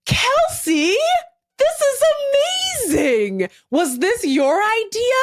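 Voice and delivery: lightly hammed sweet host; imitating Nancy. Nancy praising Kelsey in the closet, lightly gushing. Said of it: "And Nancy says".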